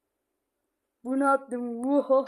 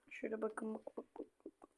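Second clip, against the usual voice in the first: hushed whisper fading to nothing; 3 semitones lower, 18 dB quieter than usual. Real, not acted. Whispering to friends while hiding in game. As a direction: breathe the words out secretly. Hiding, showing pockets quietly.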